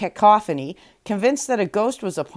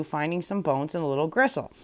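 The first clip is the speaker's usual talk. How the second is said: ordinary delivery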